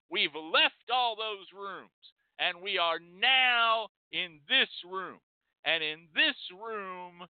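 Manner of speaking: slow, weighty announcement; slow and deliberate. Acted. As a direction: spell it out slowly for effect. Slowing down for dramatic weight.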